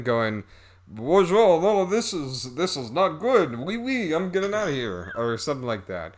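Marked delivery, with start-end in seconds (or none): 0.9-5.1 high, hammed-up French accent; mock a terrible French accent, pitched up; bad French accent. Man backing out of sex, hammed-up French accent. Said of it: "the French accents are bad".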